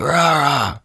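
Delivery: high-pitched pretend roar; imitated monster "rah"; mock zombie roar. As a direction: roar like a zombie, without much volume. Zombie Cap roaring.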